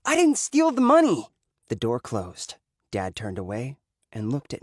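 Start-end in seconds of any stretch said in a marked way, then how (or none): 0.0-1.3 high, loud protest of innocence; much higher and louder, crying out; loud outburst. A narrator's reading. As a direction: shout it out in desperate denial. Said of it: "Uncle Nick loudly said".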